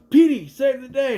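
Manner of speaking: high, bright call to the hero; mock-heroic rallying cry; excited cheer. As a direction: cheer Petey on like a fan. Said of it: "He's like".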